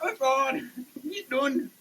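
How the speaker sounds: ordinary delivery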